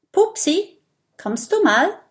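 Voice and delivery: high, carrying call. Acted. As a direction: call into the bush for a friend. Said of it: "ruft Mathilda".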